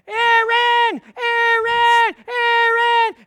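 full-force, very high imitated scream, each name drawn out; screaming child. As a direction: scream the name like a child over a squealing baby. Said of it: "they're screaming and they're shouting".

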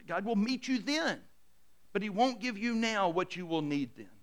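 normal talking voice

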